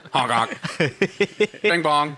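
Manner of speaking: goofy honking noises; silly clown. Pretend. Silly noises after joke.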